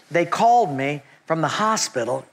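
usual speaking voice

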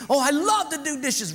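high, loud fake cheer; forced eager boy. Boy forced to do dishes, high fake cheer. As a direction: overdo the enthusiasm.

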